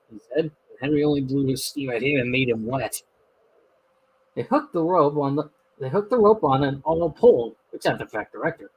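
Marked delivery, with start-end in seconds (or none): none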